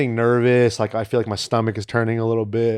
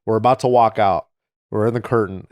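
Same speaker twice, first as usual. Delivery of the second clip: usual speaking voice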